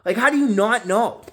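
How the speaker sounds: retold high indignant rant; high outraged scolding. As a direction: scold him in a squeaky outraged voice. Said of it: "I'm like".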